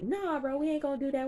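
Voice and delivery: high-pitched mock protest; imitated high-voiced refusal; retold objection. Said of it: "Miles' character was like".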